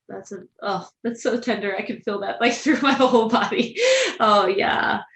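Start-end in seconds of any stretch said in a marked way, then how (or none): 2.4-5.0 laughing. Real, not acted. Host moved, laughing out loud.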